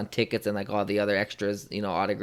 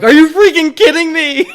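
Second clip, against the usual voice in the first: much higher, much louder squeal of disbelief; stunned delight; 17 dB louder than usual. Retold or imitated. Fan told he gets a hug, squealing disbelief. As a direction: shriek it like a dream just came true. Retelling his own shock at hug.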